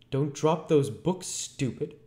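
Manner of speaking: high, loud sharp rebuke; sharp, high-pitched order. A narrator's reading. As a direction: scold him sharply.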